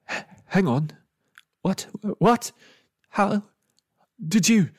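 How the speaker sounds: stammering, pitch jumping high; broken-up, incredulous questions; stunned disbelief. A narrator's reading. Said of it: "eyes locked with disbelief".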